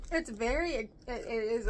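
chewing popcorn while talking; talking between bites; mouth full. Real, not acted. Seen 1: Left speaker has hand at mouth with popcorn, chewing while talking.

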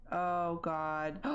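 usual speaking voice

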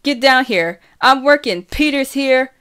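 loud, high-pitched call to another floor; yelling. A narrator's reading. Big sister calling Kitty down to the kitchen, yelling. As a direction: yell for someone to come down. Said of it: "I yell".

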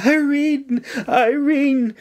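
high, distraught wail; narrator's acted anguished cry; sobbed cry. A narrator's reading. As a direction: call out in grief for a fallen friend. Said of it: "May sobbed".

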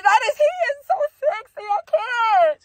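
high, rushed squeal of delight; gushing excitement. Real, not acted. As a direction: gush like you can't take it. Gushing over the scene.

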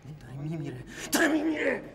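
desperate plea. Acted. Brother pleads for dying sister.

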